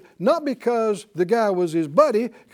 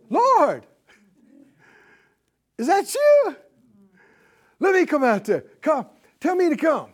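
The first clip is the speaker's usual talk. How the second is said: high, loud call to the Lord; eager Peter; 8 semitones higher, 4 dB louder than usual. Acted. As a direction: call out eagerly, begging to join in. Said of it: "Why was he so quick to go".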